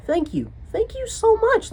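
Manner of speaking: high, squeaky overwhelmed thank-yous, slowing down; gushing thanks. Retold or imitated. Thanking the kind old lady, high and gushing. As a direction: squeak out thanks, overcome with gratitude.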